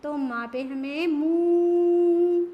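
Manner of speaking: slow emphatic teaching word; long loud oo. Shown as a demonstration. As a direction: stretch and stress the oo in मूली. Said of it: "बड़ा sound लेंगे".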